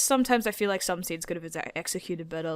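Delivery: usual speaking voice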